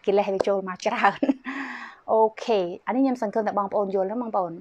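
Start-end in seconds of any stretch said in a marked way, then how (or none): 1.2-2.1 short, soft falling chuckle; soft laugh. Real, not acted. Health speaker to viewers after talk of broken relationships, soft chuckle. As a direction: let out a brief, quiet laugh.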